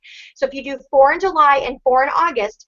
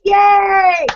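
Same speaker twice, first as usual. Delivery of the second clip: cheer; 5 semitones higher, 5 dB louder than usual. Real, not acted. Cheering top unit.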